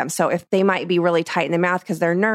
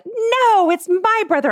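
put-on much higher, louder kid voice; imitated kid protest; 4 dB louder, slower than usual. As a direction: squeal it like an indignant child. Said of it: "they'll be like".

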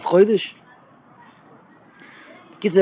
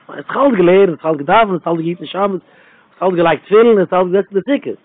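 preaching litany; 2 semitones higher, 5 dB louder than usual. Acted.

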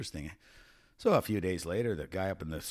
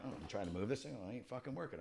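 much softer frustrated mutter; muttering to himself; 14 dB quieter, faster than usual. Retold or imitated. Passenger fighting a broken seat, soft annoyed mutter. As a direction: grumble under your breath at the seat. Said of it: "So I'm like".

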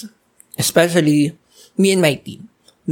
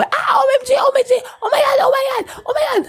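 high, tearful shrieking oh my God; screaming OMG. Retold or imitated. Dancer reliving the finale, crying and shrieking. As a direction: shriek it over and over, overwhelmed. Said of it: "sumigaw na talaga ako".

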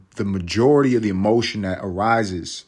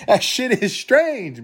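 laughing disbelief; 14 semitones higher, 4 dB louder, slower than usual. Real, not acted. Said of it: "laughing high slow".

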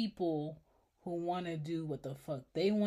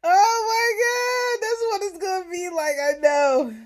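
loud wailing; 14 semitones higher, 16 dB louder than usual. Real, not acted. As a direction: wail it out in despair.

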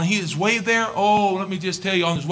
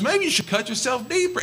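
high taunting suggestion; mock them, dry and cutting; sarcastic; 9 semitones higher than usual. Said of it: "He was sarcastic".

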